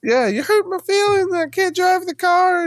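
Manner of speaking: very high, mock-weepy falsetto; mocking whiny wife. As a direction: tease her in a whiny high voice. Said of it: "then I'll go high pitched and be like".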